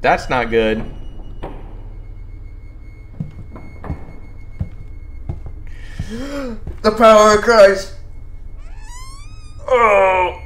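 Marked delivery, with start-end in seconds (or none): 0.9-6.8 high breathy gasp of fright; sharp scared gasp. Real, not acted. Jump scare in horror game. 6.8-10.4 scared horror game streamer. Real, not acted. Jump scare reaction.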